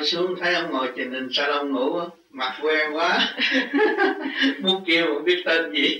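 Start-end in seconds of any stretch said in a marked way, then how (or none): 3.2-4.6 a bright burst of laughter, pitched high. Real, not acted. Teacher laughs recalling familiar face. (bật cười cao giọng)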